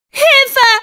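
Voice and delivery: high frightened scream for help; cry for help. Acted. Captured Meg screams for someone to help her.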